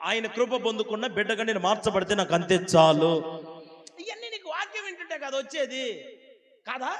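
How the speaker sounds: high, loud, fervent preaching; preaching at full force. Acted. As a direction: drive the message home to the congregation. Preaching climax again. (ఉద్రేకంగా బిగ్గరగా బోధించడం)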